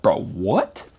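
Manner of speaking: high, incredulous inner voice; stunned disbelief. Retold or imitated. His own shocked thoughts at the cafe table, incredulous. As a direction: squeak out the disbelief. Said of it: "I'm thinking to myself".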